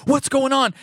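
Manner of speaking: a startled cry from the shaky footage; alarmed. Retold or imitated. Person filming panics, high and alarmed. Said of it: "It was like".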